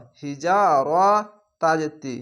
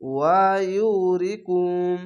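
slow drawn reading; slower than usual. Shown as a demonstration. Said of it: "কয়বার উচ্চারণ করলাম".

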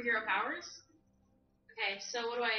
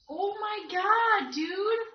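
excited; 8 semitones higher, much slower than usual. Real, not acted. Startled by the possession.

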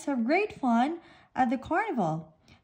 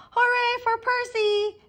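cheering; 11 semitones higher than usual. A narrator's reading. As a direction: cheer for the winner. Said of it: "cheers Pufferfish".

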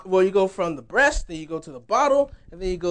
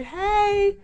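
put-on much higher, drawn-out child's call; imitated little kid; 13 semitones higher than usual. Little Nia to the worship leaders, mimicked high child voice. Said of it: "she's a kid, so".

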